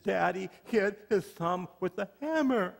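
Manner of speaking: high child voice, lightly voiced; imitating a five-year-old boy; a hint of a small boy reporting; little boy voice.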